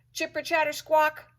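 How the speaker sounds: high, loud squawking chatter; mock squirrel squawk. A narrator's reading. Squirrel noise imitated.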